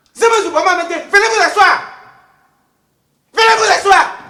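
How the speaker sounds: loud, high call to the guests; loud summons, pitch well up; calling out loudly. Acted. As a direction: shout them over to their seats. Loud host calling guests to sit.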